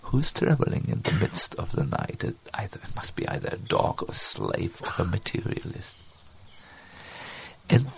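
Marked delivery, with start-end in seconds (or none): 0.0-6.0 soft, low, almost whispered repeat; hushed, low and unemphatic; overheard words. Acted. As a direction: murmur it as words sinking in. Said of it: "Rupa heard".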